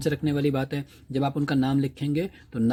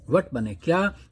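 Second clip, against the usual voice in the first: normal talking voice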